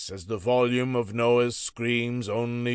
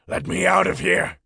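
furious prisoner, higher and louder; angry shout; 9 semitones higher, 7 dB louder than usual. A narrator's reading. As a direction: rattle the cage with your voice. Prisoner rages behind bars.

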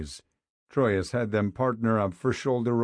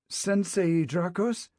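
slow, higher, unsure question; hesitant; 8 semitones higher, slower than usual. A narrator's reading. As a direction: ask haltingly, eyes down. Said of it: "the boy said hesitantly".

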